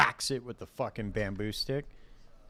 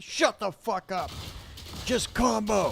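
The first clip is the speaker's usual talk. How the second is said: high, loud furious shout; screaming boss; 12 semitones higher, 3 dB louder than usual. Pretend. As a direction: scream at the worker.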